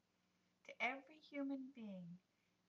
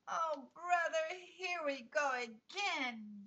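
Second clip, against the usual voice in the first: put-on slow, loud, fed-up boy's shout; exasperated yell; 6 semitones higher, 13 dB louder, slower than usual. A narrator's reading. Riaz groans at the nervous Anissa, loud exasperated yell. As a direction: bellow it out, rolling his eyes at her. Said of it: "yelled Riaz".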